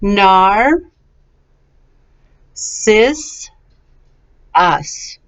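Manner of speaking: "Nar, sis, us", each part separate, long gaps between; syllable by syllable. Shown as a demonstration. Teacher has student repeat, syllable by syllable. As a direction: leave room to echo each of "Nar, sis, us". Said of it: "let's go a little bit more quickly".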